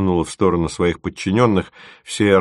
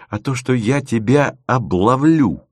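выпалил невольно; 5 semitones higher than usual. A narrator's reading. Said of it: "неожиданно для себя выпалил".

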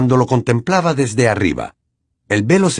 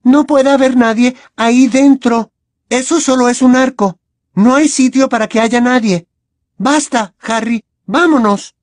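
put-on higher, louder insistent girl's voice; angry Hermione; 14 semitones higher, 5 dB louder than usual. A narrator's reading. Hermione scolding Harry to leave, higher and louder. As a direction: shut the idea down hard. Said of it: "mucho más enfadada".

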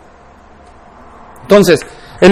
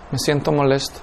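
quieter repeat; 7 semitones lower, 13 dB quieter than usual. Acted. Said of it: "pregúntame otra vez". (repetición suave, casi para sí mismo)

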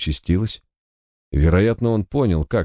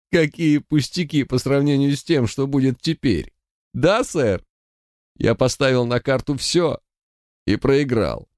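wild unhinged ranting; 8 semitones higher than usual. Acted. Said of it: "безумно рассмеялся".

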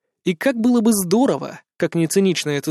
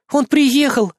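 urgent alarm; 9 semitones higher, 3 dB louder, slower than usual. Acted. Frightened woman reports news.

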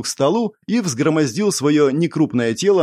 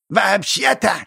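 higher creaky goblin squeak; 7 semitones higher, slower than usual. A narrator's reading. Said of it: "скрипнул Геннард Алтрис".

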